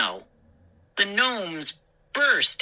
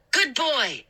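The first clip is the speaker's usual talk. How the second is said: cry; 9 semitones higher than usual. Acted. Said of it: "I cried".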